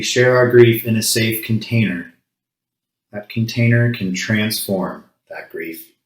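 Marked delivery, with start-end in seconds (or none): none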